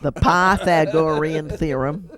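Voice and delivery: slow, laughing mock of the professor's accent on "Pythagorean theorem"; imitating the professor's accent. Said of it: "his accent distracted me".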